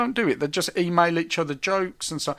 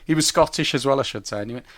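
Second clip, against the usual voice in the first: ordinary talk, nothing marked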